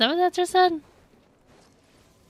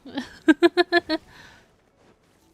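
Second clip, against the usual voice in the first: loud, high giggling burst; real high giggle, tailing off; confused laugh; 5 semitones higher, 8 dB louder than usual. Real, not acted. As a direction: laugh helplessly at your own confusion.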